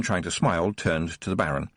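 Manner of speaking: ordinary delivery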